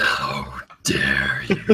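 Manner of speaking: slow, low, breathy whisper of fake offense; hammed-up betrayed whisper; mock outrage whisper. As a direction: hiss it like a wounded diva. Mock offense at partner's flub.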